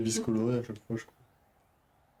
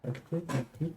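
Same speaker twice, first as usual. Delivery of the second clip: usual speaking voice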